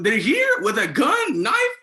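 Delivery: high, loud panicked shout; freaking out. Retold or imitated. RA hearing armed suspects are here, panicked loud shout. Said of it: "I was freaking out".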